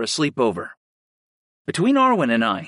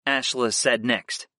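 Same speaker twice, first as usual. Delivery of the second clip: ordinary delivery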